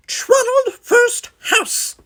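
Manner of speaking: very high, slow, crisp squeak. A narrator's reading. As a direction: announce yourself high and crisp. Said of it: "in a high, crisp voice".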